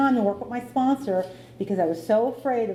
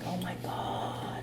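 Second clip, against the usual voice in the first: hushed dismay; 10 dB quieter, much slower than usual. Retold or imitated. Retold dread at amends.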